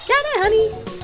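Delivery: high, bubbly mother voice; cheerful mom voice. Acted. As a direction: chirp it like a doting mom.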